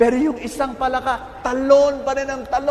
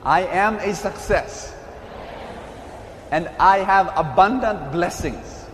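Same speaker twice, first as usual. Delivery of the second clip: slow, lower, deliberate proclamation; repeat-after-me declaration; 4 semitones lower, slower than usual. Real, not acted. Preacher leading brothers and sisters to say this with him, slow declaration. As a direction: declare it slowly in phrases for a congregation to repeat. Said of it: "say this with me".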